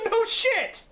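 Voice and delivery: high, laughing outburst; laughing exclamation. Real, not acted. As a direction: crack up while exclaiming. Laughing at his own impression.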